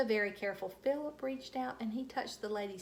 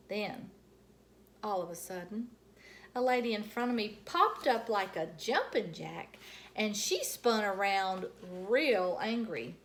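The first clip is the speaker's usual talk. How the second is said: ordinary delivery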